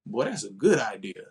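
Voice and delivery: mock-amazed reaction with swooping pitch; retold realization. Author replying to his friend's book suggestion, struck by the idea. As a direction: act out the idea suddenly landing.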